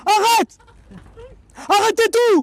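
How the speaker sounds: high frantic shouting; excited yelling. Real, not acted. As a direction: yell it in a panic. Fish fighting, yelling excitedly.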